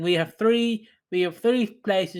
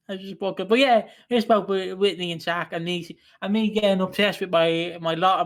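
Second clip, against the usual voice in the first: usual speaking voice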